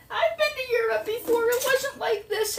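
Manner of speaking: high, indignant mother voice; high whiny mother protesting to her daughter; mother's protest. Retold or imitated. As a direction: pitch up as the offended mother.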